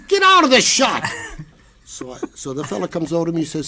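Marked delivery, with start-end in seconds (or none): 0.1-1.0 high, loud order; director yelling. Retold or imitated. Director ordering Sidney out of the shot, loud. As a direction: shout him off the set.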